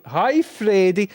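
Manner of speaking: high, slow sing-song to the dog; pet talk. Real, not acted. Owner greets his dog Freddy in a high slow coo.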